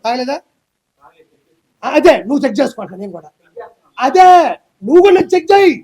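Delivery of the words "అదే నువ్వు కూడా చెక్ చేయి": heated challenge. Real, not acted.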